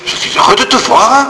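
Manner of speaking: mock the Alsatian way of speaking; mock Alsatian accent. Teasing friends with an exaggerated mock Alsatian accent. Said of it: "l'accent alsacien".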